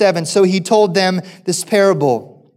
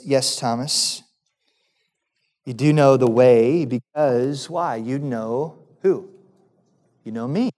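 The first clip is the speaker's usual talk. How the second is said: gentle reply; 8 semitones lower, 5 dB quieter than usual. Acted. Jesus gently answering Thomas.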